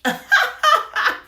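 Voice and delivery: imitating a loud, high mocking cackle; mock the rep's laugh at you, big and high. Vlogger imitating the license rep laughing at her. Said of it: "this lady laughed at me".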